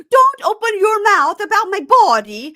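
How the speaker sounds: high, loud shrieked accusation; mimicking her screaming at him in front of everyone; hysterical yelling. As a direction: screech it, mocking her hysteria. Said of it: "hysterically yelling".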